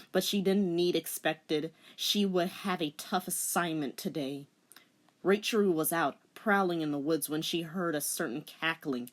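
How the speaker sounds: ordinary delivery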